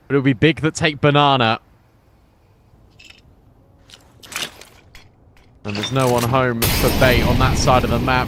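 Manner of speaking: esports caster. Acted. Live play-by-play cast.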